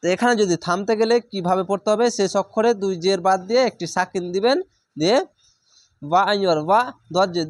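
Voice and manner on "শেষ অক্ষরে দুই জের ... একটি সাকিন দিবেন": usual speaking voice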